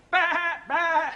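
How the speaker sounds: demonstrating a sheep's "baa"; sheep bleat imitation. Shown as a demonstration. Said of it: "make the actual sounds".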